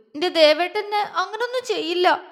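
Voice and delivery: a touch of sobbing through the words. Acted. Frightened Jwala protesting to her friends, tearfully. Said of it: "അവൾ തേങ്ങിക്കൊണ്ട് പറഞ്ഞു".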